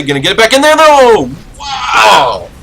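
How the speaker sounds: loud, high-pitched excited commentary; real excitement, voice shooting up; excited shout. Real, not acted. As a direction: yell the risky play as it happens. Caster reacting to risky play.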